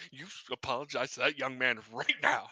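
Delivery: lightly voiced stern father; softly scolding like a dad; understated angry dad telling his kid off; hint of an angry dad. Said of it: "the angry dad look".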